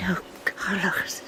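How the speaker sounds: urgent hushed whisper. Acted. Evelyn hushing Carlos in the corridor, whispering. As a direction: keep it breathless and secret.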